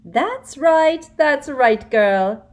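high, loud eager encouragement; a sugary predator; Umbridge voice. A narrator's reading. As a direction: Umbridge urging Hermione. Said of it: "said Umbridge".

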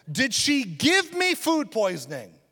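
ordinary delivery

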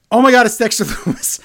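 hammed-up surprise; mock shock. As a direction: gasp it out in pretend alarm. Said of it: "he was amazing with the whole".

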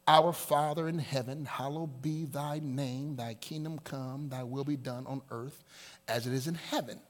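low, soft, reverent prayer; solemn prayer recitation. Acted. Preacher recites the Lord's Prayer as model, solemn and soft. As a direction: recite it low and hushed, evenly.

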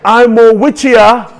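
put-on slow, high Nigerian accent; drawn-out Nigerian preacher imitation. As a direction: lead the chant in a Nigerian accent. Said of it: "use your Nigerian accent".

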